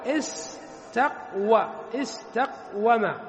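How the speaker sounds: drawn out slowly, broken at the qaf; syllable by syllable. Shown as a demonstration. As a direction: sound the word out slowly for learners. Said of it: "فنقول". (نطق بطيء مقطّع)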